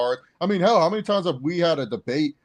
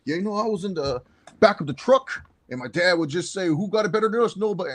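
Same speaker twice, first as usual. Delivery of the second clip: raised, goofy storytelling impression; goofy storyteller; 6 semitones higher, 3 dB louder than usual. Coach telling players about his dad, goofy impression. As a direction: mimic a coach spinning a folksy yarn. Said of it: "Jim tells his goofy stories".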